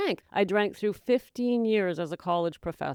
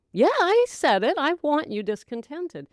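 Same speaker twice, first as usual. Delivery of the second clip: ordinary delivery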